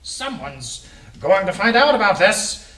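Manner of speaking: agitated, high, loud character voice; Mister Light. Acted. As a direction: cry out in high-pitched alarm. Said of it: "He's agitated in this voice".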